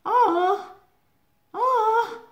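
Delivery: high, loud, hollow fake "Oh, oh"; mock a hammed-up sympathetic "Oh"; fake sympathy. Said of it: "with such a fake intonation".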